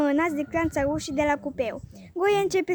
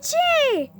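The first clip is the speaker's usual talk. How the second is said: high startled cry; alarmed ladies; 13 semitones higher, 7 dB louder than usual. A narrator's reading. The ladies jump at Goe's scream, startled. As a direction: jump up in a fright.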